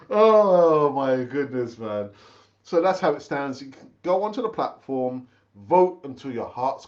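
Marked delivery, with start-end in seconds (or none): 0.1-2.1 amused disbelief. Real, not acted. Reacting to the vote split.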